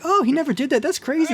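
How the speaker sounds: naive surprise. Retold or imitated. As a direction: act freshly amazed at the correction. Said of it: "I'm like".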